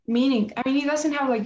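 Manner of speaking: usual speaking voice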